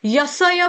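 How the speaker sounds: यसय as ya-sa-ya with full स; wrong pronunciation. Shown as a demonstration. Teacher explaining the full स mistake, three-syllable mispronunciation. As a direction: give every letter of यसय its vowel. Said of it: "बोलने से स आपका पूर्ण हो जाएगा".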